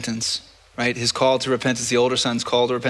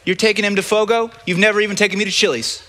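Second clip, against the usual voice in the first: whiny jealous complaint; 6 semitones higher, faster than usual. Pretend. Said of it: "He's like".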